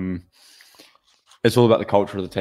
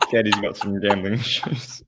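laughing; 3 dB quieter than usual. Real, not acted. Teasing mock announcement, laughing.

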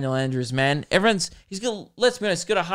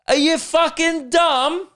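much higher, louder, slow British rant; angry British accent; 11 semitones higher, 4 dB louder, slower than usual. Pretend. Ranting at the journalists in a furious British accent. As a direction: snap at them in a mock British accent. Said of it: "Apparently I turn British where I get mad".

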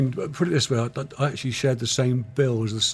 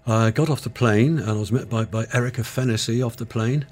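normal talking voice

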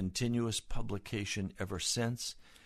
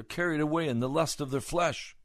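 higher-pitched, forceful sermon delivery building in volume; impassioned preaching; 9 semitones higher, 7 dB louder than usual. Real, not acted. Preacher urges congregation to see their rags, impassioned preaching. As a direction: press each phrase home like a revival preacher.

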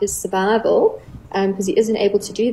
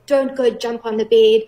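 playful mock warning. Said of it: "And I say".

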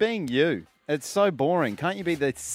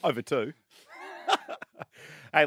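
normal talking voice

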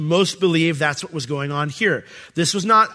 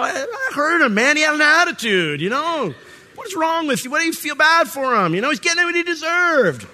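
higher, indignant ranting with laughs; mock indignant; 10 semitones higher than usual. Husband scoffs to wife about the restaurant child, mock indignant. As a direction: scoff at the crying kid.

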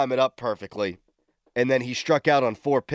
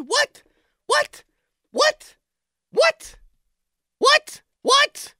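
much higher, louder squeals of "What?"; shrieking disbelief; 4 dB louder, much slower than usual. Real, not acted. Host mocking the trade call, shrieking disbelief. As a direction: repeat it like a broken alarm.

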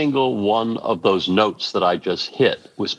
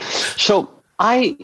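usual speaking voice

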